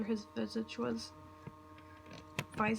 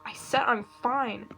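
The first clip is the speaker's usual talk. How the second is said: louder, slow, biting "I said I'm fine"; angry snap; 7 dB louder, slower than usual. Acted. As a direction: shut him down hard, word by word. Tom snaps at worried Tord.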